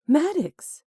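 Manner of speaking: high startled squeak of a name. A narrator's reading. Woman startled by her ex squeaks his name. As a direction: squeak it out, caught off guard. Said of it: "she squeaked".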